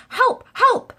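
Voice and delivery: high, loud cries for help; calling for help. Acted. Boy phoning a policeman, loud high cries. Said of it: "I said".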